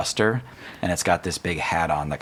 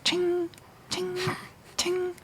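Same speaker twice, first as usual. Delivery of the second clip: high metallic ting sounds; ping each step like a spur; clanging spurs; 6 dB quieter, slower than usual. Shown as a demonstration. GM mimics the talon caps clanging, high ting. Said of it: "clanging sound when it walks".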